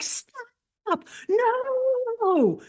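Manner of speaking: very high, frantic squeaky little-man voice; panicked tiny foreman. Retold or imitated. Foreman warns the miners of a gas leak, squeaky panic.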